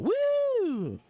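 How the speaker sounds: long, high "woo"; celebratory whoop. Real, not acted. Drinker cheering a drinking roll, high whoop.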